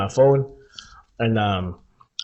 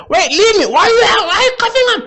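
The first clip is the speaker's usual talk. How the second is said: high, loud, frantic falsetto; hammed-up high panicked girl voice; mock pleading sister; 6 dB louder than usual. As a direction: plead like a tipsy girl at the cops. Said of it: "I was like".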